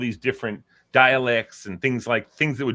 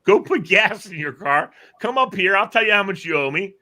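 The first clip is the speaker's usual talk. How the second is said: high, loud mock-exasperated attendant; imitated gas station attendant; 9 semitones higher, 6 dB louder than usual. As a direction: play the baffled attendant directing a clueless customer, pitched up. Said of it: "what he's looking at you like".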